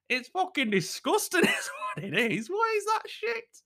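high comic shout, breaking with laughter; disgusted outburst. Retold or imitated. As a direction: wail the verdict in mock outrage. Shouting verdict at missus.

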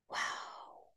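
breathless, barely voiced wow; awed whisper. To herself, moved by her dad's trust, a hushed whisper. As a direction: let the wow fall to a hush of awe.

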